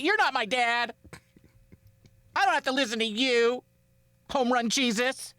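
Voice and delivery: put-on high-pitched defiant kid-style protest; mock defiance. Host playing at refusing to listen to the rookie, mock defiance. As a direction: push back in a high, put-on voice. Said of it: "I'd be like".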